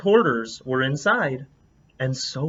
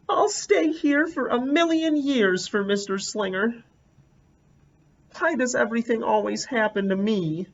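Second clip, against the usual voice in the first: much higher, self-pitying wail; sulky kid; 6 semitones higher than usual. Acted. Sulking in the uncooperative chair, a self-pitying girl's whine.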